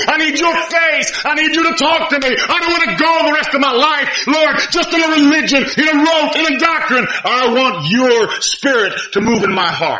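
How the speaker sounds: high, loud pleading preacher; fervent preaching. Acted. Preacher voicing a sinner's prayer to God, fervent and high.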